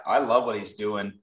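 usual speaking voice

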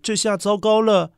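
ordinary delivery

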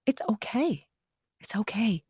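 quiet comforting repeat; reassuring. Real, not acted. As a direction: soothe him softly. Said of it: "reassuring the boy".

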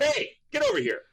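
father summoning his son, mock-voiced and high; dad calling. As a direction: call him over like an amused father. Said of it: "he's like".

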